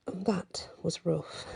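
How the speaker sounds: soft slow whisper; hushed, slow whisper. A narrator's reading. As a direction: breathe it out sadly. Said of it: "Aviana's voice comes out".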